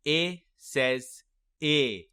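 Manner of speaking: slow teaching "A says A", ending on the letter name; last "A" long, rhyming with say; spaced-out "A says A", both as in bake; long vowel. Shown as a demonstration. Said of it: "The first long vowel sound we will practice".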